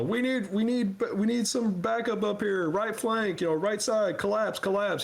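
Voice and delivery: high-pitched frantic backup call; acted alarm, strained and high; urgent callout.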